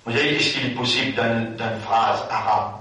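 normal talking voice

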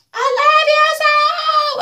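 very high, slow, drawn-out mocking voice; mock drunk Jay. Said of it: "we hear Jay ask".

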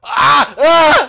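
high, loud terrified yells; panicked scream. Real, not acted. Sudden panic in game.

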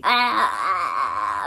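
loud drawn-out "aah"; overwhelmed squeal. Real, not acted. Remembering her cute date, an overwhelmed loud squeal. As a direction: let out a long cry of giddy overwhelm.

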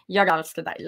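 low, throaty Stockholm accent; throaty Swedish. Shown as a demonstration. Showing where Swedish sits, throaty Stockholm accent. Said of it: "it's just, like, in the throat".

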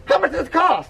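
high-pitched, indignant question; alarmed dad. Acted. Dad asks the price, high and alarmed. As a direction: demand the price, pitch jumping up.

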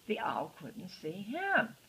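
ordinary delivery, nothing marked

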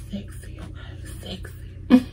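barely-there whisper; whispered aside. Real, not acted. Vlogger on how British men sound, whispered aside. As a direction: murmur it under your breath, no voice. Swooning over British men's voices.

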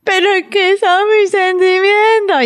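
put-on high, slow, drawn-out child's whine; whiny child. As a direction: stretch it out like a sulking kid. Daughter's whining reply. (quejido lento y agudo de niña)

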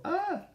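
high delighted gasp; a quick high cry of discovery; glad cry. A narrator's reading. Pastorcita finds the tails on the tree, high cry.

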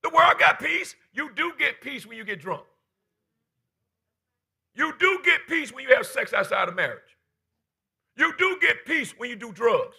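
shouted list, building; full-force preaching. Acted.

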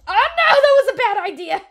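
very high, loud scream; panicked shriek. Real, not acted. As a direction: shriek as it goes wrong. Attack backfires.

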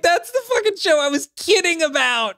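shocked exclaim; incredulous outburst. Real, not acted. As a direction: blurt it out in disbelief. Disbelief at his joke becoming real.

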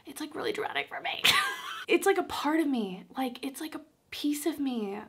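real tearful voice, high and breaking into whisper; choked up. Real, not acted. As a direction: fight back tears, voice thinning to air. Tearing up over lost ornaments.

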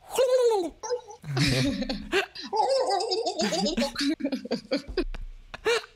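long high gobbling call; turkey impression. Showing the host his favourite turkey impression. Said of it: "Sounds a little bit like this".

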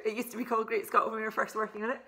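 ordinary talk

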